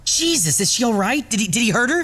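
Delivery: shocked, pitched high; startled high worried questions; alarmed. Acted. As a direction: jolt up in alarm for her safety. Hearing the woman was attacked.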